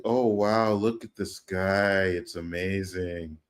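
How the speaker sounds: slow, soft, trailing off stoned; mock stoned awe. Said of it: "Were you high when you watched".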